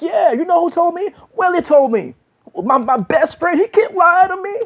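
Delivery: high-pitched, heated comeback; defensive outburst. Acted. Boyfriend naming his best friend as witness, high and defensive. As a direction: fire back as the cornered boyfriend, voice way up. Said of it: "the man is gonna be defenseless".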